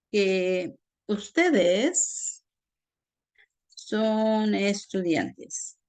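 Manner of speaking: very slow, stretched words; slow dictation. Shown as a demonstration. Teacher giving students an example with sé, slow dictation. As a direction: draw out each word as you write.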